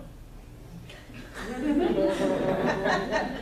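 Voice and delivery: short real laugh with a rising end; amused laugh. Real, not acted. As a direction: laugh at the misunderstanding. Speaker laughs at the story.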